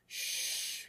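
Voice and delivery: long soft hush; "shh" to quiet someone down; drawn-out, quiet shush. A narrator's reading. Brother hushes the shouting Ruiz.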